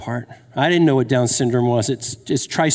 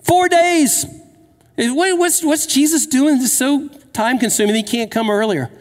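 exasperated; 9 semitones higher than usual. Retold or imitated. Sisters' exasperated complaint voiced.

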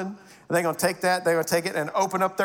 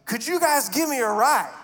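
higher-pitched stranger calling over; raised, eager mock request; imitating the man; 6 semitones higher than usual. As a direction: call out like a hopeful stranger. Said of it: "I hear out of this man's voice".

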